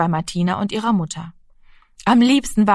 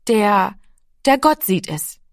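put-on halting small boy; slower than usual. A narrator's reading. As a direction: struggle for words, then let it out. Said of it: "Er hätte keine Worte dafür gefunden".

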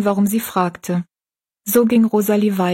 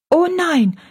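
higher, decided little no; firm refusal; 10 semitones higher than usual. A narrator's reading. Rosalie refusing food from the kitchen, decided and higher. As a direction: push the offer away firmly. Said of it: "wehrte Rosalie mit Entschiedenheit ab".

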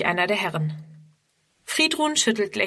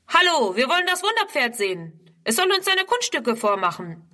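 rowdy yelling; 6 semitones higher than usual. A narrator's reading. Youths bellow at Ernst across the paddock, rowdy and high. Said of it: "brüllen sie Ernst zu".

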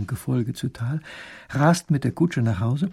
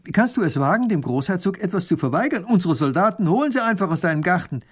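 high, indignant baron's rebuke; stern; 7 semitones higher, 4 dB louder than usual. A narrator's reading. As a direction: scold him for daring to refuse. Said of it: "Baron von Raffigny sagt streng".

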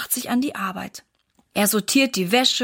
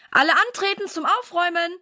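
high, quick rallying call; brisk summons, pitched up; calling through house; 7 semitones higher, 3 dB louder, faster than usual. A narrator's reading. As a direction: call the whole household to muster. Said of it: "ruft er durch das ganze Haus".

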